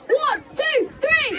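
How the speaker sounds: high shouted countdown; rousing count. Real, not acted. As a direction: whip the crowd up on the count. Building count to loud cheer.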